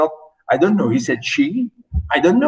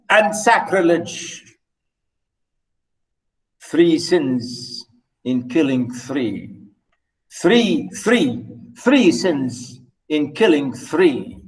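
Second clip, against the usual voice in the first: slow, weighty recitation of verse; slow poem reading; 3 semitones lower, slower than usual. Acted. Lecturer reading the Donne poem to students, slow and deliberate. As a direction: dwell on each word of the poem. Reads the poem aloud slowly.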